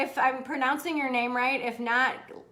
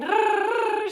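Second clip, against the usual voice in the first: imitated purr; 8 semitones higher, 4 dB louder than usual. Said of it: "And again".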